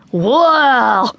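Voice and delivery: excited gorilla whoop, falling away; gorilla shout. A narrator's reading. Boy jumping up and down at the gorillas, shouting. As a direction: call out like a young gorilla. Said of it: "he shouted".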